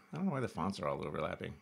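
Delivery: usual speaking voice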